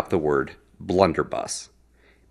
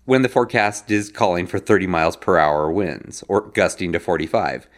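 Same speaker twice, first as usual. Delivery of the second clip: normal talking voice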